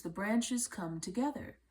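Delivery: plain reading voice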